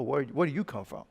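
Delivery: usual speaking voice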